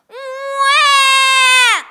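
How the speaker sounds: put-on huge high loud baby cry; loud, high, wailing baby howl. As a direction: bawl like a giant baby. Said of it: "the loudest shout ever heard".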